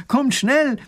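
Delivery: very high, loud urgent shout; urgent call. Acted. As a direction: hurry them up at full voice. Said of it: "ruft der Professor".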